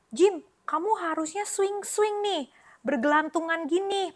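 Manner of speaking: bright, lifted monkey chatter; chirpy monkeys. Acted. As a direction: coax him to swing like playful monkeys. Monkeys voiced in children's story.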